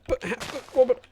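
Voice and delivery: high-pitched spluttering protest; mock dismay. Streamer imagining being banned from Google, mock-horrified protest. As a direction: plead and stammer in exaggerated dismay. Acts out panic at Google ban.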